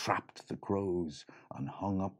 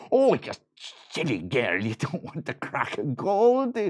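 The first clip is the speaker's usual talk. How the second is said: higher, louder mock scolding; scolding leprechaun; 6 semitones higher, 6 dB louder than usual. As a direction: tut at the silly girl.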